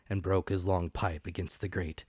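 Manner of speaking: ordinary delivery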